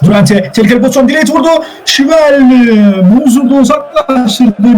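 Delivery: rising to a high, urgent pitch; excited chance call. Real, not acted. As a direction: commentator seizing on danger at goal. Calls a dangerous chance.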